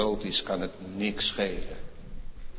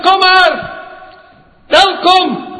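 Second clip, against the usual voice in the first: loud, much higher triumphant preaching; full-force preaching; 17 dB louder than usual. Acted. Climactic preaching. (luid en hoog triomfantelijk prediken)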